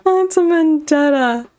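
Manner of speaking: hammed-up dramatic vendetta cry; dramatic declaration. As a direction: proclaim the vendetta theatrically. Repeats line in two voices.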